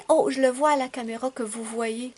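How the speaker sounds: loud eager remark to viewers; excited. Real, not acted. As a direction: share the thrill with the camera.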